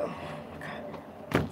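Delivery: breathless, slow whisper of disbelief; whispered exasperation. Retold or imitated. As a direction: mutter it under your breath, fed up and worn out. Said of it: "it's like".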